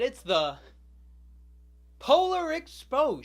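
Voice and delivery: loud, high, drawn-out announcement; mock grand reveal. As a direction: belt it out slowly like a big reveal. Mock grand reveal of wrong train.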